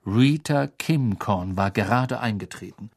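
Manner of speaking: ordinary delivery, nothing marked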